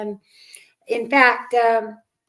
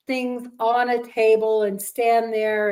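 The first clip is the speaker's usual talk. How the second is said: ordinary talk, nothing marked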